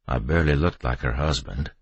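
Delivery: plain reading voice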